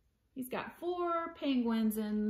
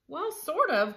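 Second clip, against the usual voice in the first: higher, louder storybook call; lively raised-voice answer; animated cry; 6 semitones higher, 8 dB louder than usual. A narrator's reading. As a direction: call it out for all the cats. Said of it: "cried the cats".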